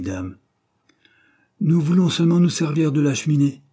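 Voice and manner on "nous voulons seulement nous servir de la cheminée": loud, high complaint; peevish, insistent objection; grumbling. A narrator's reading. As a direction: grumble with raised voice. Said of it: "grommela Dobbs".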